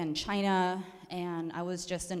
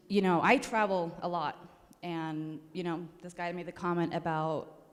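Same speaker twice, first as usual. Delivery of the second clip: ordinary talk, nothing marked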